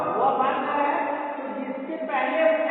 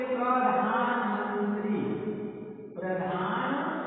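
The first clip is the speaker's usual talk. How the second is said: slow teaching demo; 4 semitones lower, 4 dB quieter, slower than usual. Shown as a demonstration. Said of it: "अब इसको बोलेंगे".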